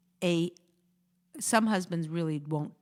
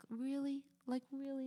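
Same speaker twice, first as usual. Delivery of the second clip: hushed, higher-pitched disbelief; quietly seething; 6 semitones higher, 10 dB quieter than usual. Acted. Wife reacts to husband's faint praise, quietly seething. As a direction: hold back boiling anger in a small, incredulous voice. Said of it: "your blood starts to boil".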